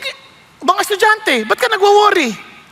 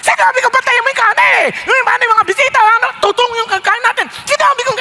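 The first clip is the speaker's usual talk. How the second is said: shouting hostess; 6 semitones higher, faster than usual. Retold or imitated. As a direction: yell at the helpers in a rage. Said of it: "nakasigaw na nasigaw". (galit na pasigaw na sermon)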